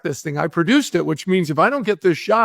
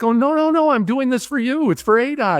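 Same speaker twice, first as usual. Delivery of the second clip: frantic pleading; 7 semitones higher than usual. Retold or imitated. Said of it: "And I'm like".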